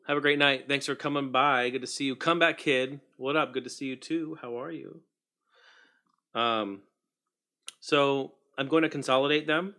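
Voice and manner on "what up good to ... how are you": ordinary delivery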